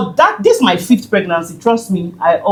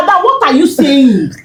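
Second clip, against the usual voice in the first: disbelieving protest; 6 semitones higher than usual. Retold or imitated. Protesting to nurse.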